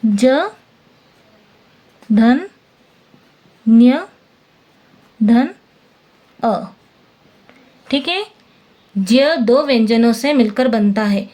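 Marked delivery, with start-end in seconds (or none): 0.0-5.5 each piece said alone, louder, with long gaps; sounds split apart. Shown as a demonstration. Teacher splits the letter for students, piece by piece. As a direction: break ज्ञ into ज and न्य, one piece at a time. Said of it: "देखो".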